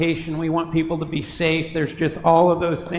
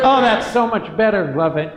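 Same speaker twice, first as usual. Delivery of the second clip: normal talking voice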